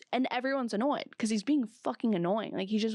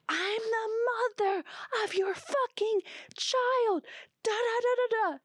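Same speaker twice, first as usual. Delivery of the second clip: mock her outraged yell, pitched way up; triggered outburst; 13 semitones higher than usual. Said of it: "Michelle is beyond triggered".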